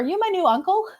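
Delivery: high, lightly voiced small child asking; imitating a little girl. Recalling her niece meeting Ben, high imitated child voice. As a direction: play the four-year-old niece, innocently curious.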